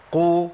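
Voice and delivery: قو with rounded lips; rounded qaf demo. Shown as a demonstration. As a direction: say قو with damma. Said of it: "القاف المضمومة".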